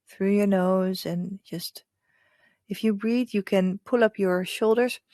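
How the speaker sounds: hushed guide leading breath and visualization; hypnotic meditation voice. Acted. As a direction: lull them gently into calm. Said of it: "that's my hypnotic voice".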